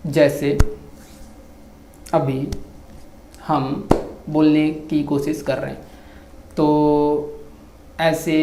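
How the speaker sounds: drawn-out words with long pauses; slow gentle teacher. Shown as a demonstration. Vlogger demonstrating a slow teacher's pace, drawn out. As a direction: talk slowly, like a patient teacher. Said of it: "teacher बहुत प्यार से बोलता है".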